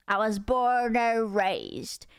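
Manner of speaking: slow, put-on Texas drawl; hammed-up Texan accent. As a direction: pass yourself off as a born Texan, slowly. Pretends to be an American.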